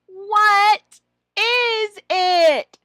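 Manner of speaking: put-on high, slow and loud question to a child; teacher voice. Shown as a demonstration. Trainer shows the student prompt, slow and loud. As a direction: ask it slowly like a cheerful teacher. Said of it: "you can ask the student".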